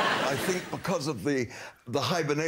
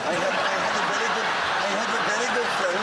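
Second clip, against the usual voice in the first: higher-pitched mock Welsh accent; put-on Welsh; 4 semitones higher, much faster than usual. Shown as a demonstration. Cricket commentator shows the panel his restaurant disguise, in a Welsh accent. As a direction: try a bit of Welsh, pitched up. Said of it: "a little bit of Welsh".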